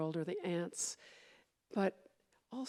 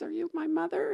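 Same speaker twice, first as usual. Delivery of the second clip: little bird asking; 9 semitones higher, 4 dB louder than usual. A narrator's reading. Baby bird asks the hen.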